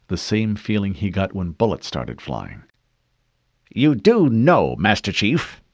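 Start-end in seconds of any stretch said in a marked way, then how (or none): 3.7-5.6 slow, pointed questioning; stern officer voice. Acted. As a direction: press the soldier firmly. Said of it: "the first male voice said".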